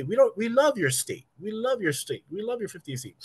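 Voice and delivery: high-pitched mock tourist gushing; exaggerated high praise, tapering in volume; imitated tourist. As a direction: put on a visitor's high, sing-song voice. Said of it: "They don't say".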